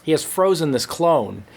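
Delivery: ordinary delivery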